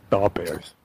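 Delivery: broad Chicago sports fan accent. Shown as a demonstration. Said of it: "I read it in a Chicago accent".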